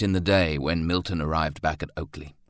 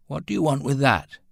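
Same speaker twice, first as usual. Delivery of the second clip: higher, pointed query; challenging question; 9 semitones higher, 5 dB louder than usual. A narrator's reading. Wilfred questions his brother about the club, challenging. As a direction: raise the pitch on a pointed question.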